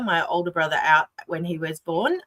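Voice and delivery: ordinary delivery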